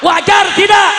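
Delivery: high, very loud demand; rallying shout. Real, not acted. Preacher asks if drivers' anger is fair, rallying shout. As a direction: shout the question across the crowd.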